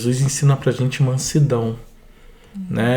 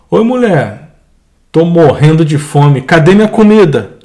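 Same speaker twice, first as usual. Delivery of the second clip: mock rude demand; 2 semitones higher, 7 dB louder than usual. Husband demanding food, rude scene.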